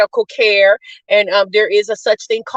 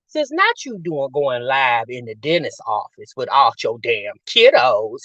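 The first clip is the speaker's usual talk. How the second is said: low disbelief; 10 semitones lower, 4 dB quieter than usual. Retold or imitated. Acting out own low-voiced disbelief.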